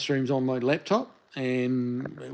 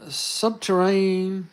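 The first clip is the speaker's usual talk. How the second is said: slow, higher, syllable by syllable; sounding it out; 6 semitones higher, much slower than usual. Real, not acted. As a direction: puzzle out the word subterranean slowly. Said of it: "the writing's a little small on my phone screen".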